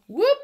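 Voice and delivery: sudden loud high whoop; startled whoop. Real, not acted. Nail artist surprised by the cornstarch bag, loud whoop. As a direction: yelp as the bag pops.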